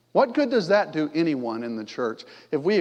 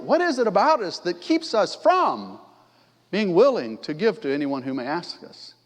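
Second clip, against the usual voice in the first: ordinary talk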